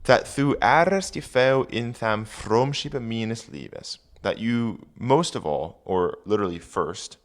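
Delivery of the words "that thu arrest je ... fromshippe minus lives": put-on reading Old English aloud; careful Old English recitation. Shown as a demonstration. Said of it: "rereading the Old English".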